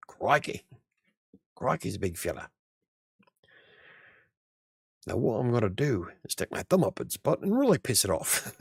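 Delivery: hammed-up mock Australian, swinging up and down; terrible Australian accent. Streamer joking about animals, terrible Australian accent. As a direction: do a bad Aussie wildlife presenter accent. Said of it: "that was probably a terrible Australian accent".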